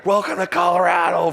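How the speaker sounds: "Welcome to Colorado" called out high and loud like a jeering crowd; mocking crowd. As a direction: jeer it like fans in the stands. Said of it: "from the crowd".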